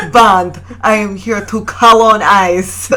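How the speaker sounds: hammed-up villain announcing his plan in a vague Latin accent; mock Bond villain accent. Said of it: "that's me as a Bond villain".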